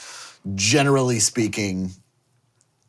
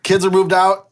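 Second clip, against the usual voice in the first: higher-pitched mock chat between spouses; imagined empty nester; 8 semitones higher than usual. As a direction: pitch it up, playing a parent proposing plans to their honey. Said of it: "initially my brain was like".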